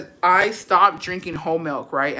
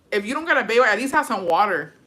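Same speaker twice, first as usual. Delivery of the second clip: ordinary talk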